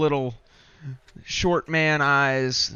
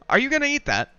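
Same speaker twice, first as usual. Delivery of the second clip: higher, put-on NPC voice; 12 semitones higher, faster than usual. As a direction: ask in a higher character voice.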